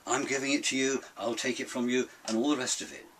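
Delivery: usual speaking voice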